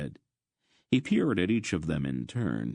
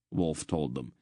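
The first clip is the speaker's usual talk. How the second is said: plain reading voice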